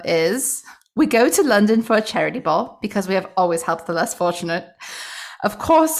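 put-on poor British accent. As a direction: read it out in a hammed-up British accent. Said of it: "go with the British accent today, the poor British accent".

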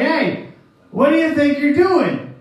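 hammed-up donkey owner calling out; angry owner. Owner catching the disciples, mock indignant. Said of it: "the owners come out and they're like".

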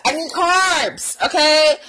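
high, drawn-out emphatic plea; comic exasperated insistence; insistent demand. Retold or imitated. Acted demand for carbs.

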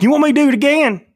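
high, fast mocking question; mimicking Chris. Mimicking Chris's eager offer back at him, high and fast. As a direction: squeak it out teasingly. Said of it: "You were like".

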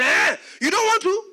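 loud, high taunting question; mock taunt. Servant taunting the frightened son, loud and high. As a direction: pounce on his weakness, playing it up. Blackmailer's retold taunting reply.

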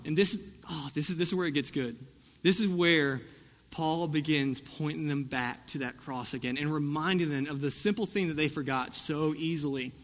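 usual speaking voice

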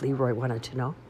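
ordinary delivery

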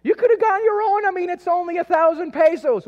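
put-on small boy scolding his mom over his ice cream; possessed kid protest. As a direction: play the kid defending his ice cream, going shrill with indignation. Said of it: "obviously I got kind of possessed".

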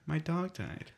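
imitated small, hushed voice; soft, breath-only mock sadness; hurt whisper. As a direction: whisper it like a wounded friend. Retelling friend's sad reaction to joke.

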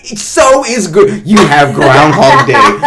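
slow, loud, high exasperation; heated rant. Real, not acted. Co-host arguing back about the calendar, heated shouting. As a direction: stretch the words out in loud indignation. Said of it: "you getting heated".

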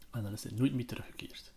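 hushed, ominous whisper; dramatic whisper. Acted. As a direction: drop to a whisper for the reveal. Ominous end of character's fate.

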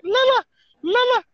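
high loud honking call; goose call. Retold or imitated. Hunter calling down snow geese with a high honk. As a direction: call the geese like a decoy caller.

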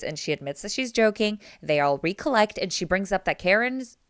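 ordinary talk, nothing marked

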